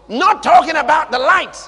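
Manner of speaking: loud, high declaration; fiery pulpit shout; preaching shout. Real, not acted. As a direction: bellow it to the back row. Preacher punches contrast.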